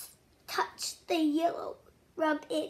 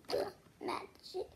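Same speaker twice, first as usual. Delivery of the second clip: much softer, mysterious whisper; magical whisper; 11 dB quieter than usual. Acted. Soft magical whisper, praising the child's touch. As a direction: breathe out the magic words.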